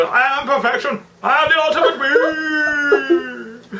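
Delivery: high, slow villain boast; Cell impression. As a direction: drawl it like a smug android villain. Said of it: "do a Cell thing".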